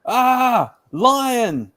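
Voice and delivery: high, loud startled shout; startled cry. Acted. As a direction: cry out in fright at the lion. Boy startled by lion.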